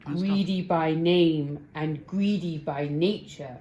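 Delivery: slow, loud booming giant; giant bellowing. A narrator's reading. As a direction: bellow it down slowly. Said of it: "he bellowed".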